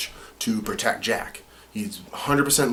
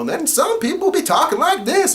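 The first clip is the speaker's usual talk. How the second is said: much higher, louder cartoon voice; different character voice; 7 dB louder than usual. Shown as a demonstration. The voice actor showing his range, a high cartoon voice. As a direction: pitch it way up for another soldier. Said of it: "a different dialect, a different voice".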